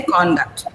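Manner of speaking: conduct as CON-duct; first-syllable stress. Shown as a demonstration. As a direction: put the weight on CON in conduct. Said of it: "this is a noun".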